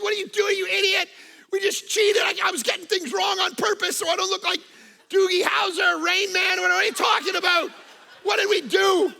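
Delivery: retold angry shout. Preacher retelling shouting at his friend after the exam, angry yell. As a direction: blast your friend for cheating. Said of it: "I'm like".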